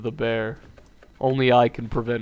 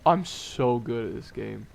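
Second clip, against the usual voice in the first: ordinary talk, nothing marked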